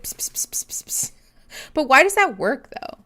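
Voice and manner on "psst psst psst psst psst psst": unvoiced cat-calling psst; soft psst psst to lure a cat; whispered psst. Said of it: "Hellfire Fox said".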